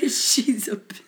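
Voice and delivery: whispered through laughter; laughing whisper. Real, not acted. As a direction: giggle it under your breath. Echoing funny quote, laughing.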